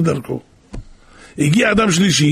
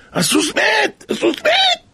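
workers' alarmed cry, rising higher and higher; retold shouting; slower than usual. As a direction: yell the news of the dead horse in rising panic. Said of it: "הפועלים שלו צועקים". (צעקה מבוהלת שעולה וגוברת)

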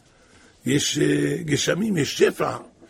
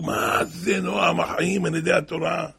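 usual speaking voice

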